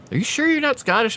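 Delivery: high-pitched mock disbelief; incredulous question. Retells shopkeeper's surprised question.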